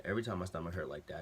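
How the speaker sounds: ordinary talk, nothing marked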